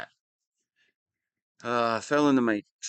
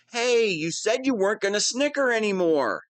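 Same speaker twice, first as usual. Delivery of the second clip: higher, fed-up scolding of the giggler; annoyed complaint; 6 semitones higher than usual. Acted. Villain scolds his captive for snickering, annoyed. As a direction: cut off the speech to tell her off.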